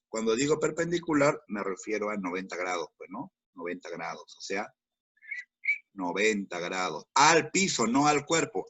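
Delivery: usual speaking voice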